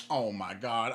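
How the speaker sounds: low, weary mock groan of reaction; weary exasperation. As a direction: retell it low, fed up. Retelling his weary reaction.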